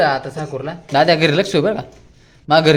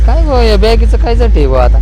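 loud joking; 8 semitones higher, 10 dB louder than usual. Real, not acted. Loud joking about bag.

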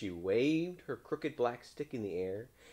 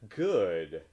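tag; 2 semitones lower, 8 dB louder than usual. A narrator's reading. Townspeople accept his service.

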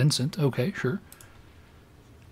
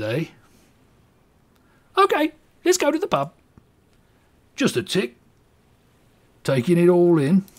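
much higher hammed-up British voice; British accent; 6 semitones higher than usual. [name] hoping for a Roman jug, British accent. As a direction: play the cheery Englishman to the hilt. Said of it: "apologize to any person of English descent".

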